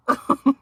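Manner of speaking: short, high, loud burst of laughter; real laugh bursting out mid-sentence; amused laugh. Real, not acted. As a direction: crack up briefly. Amused at calling dog names.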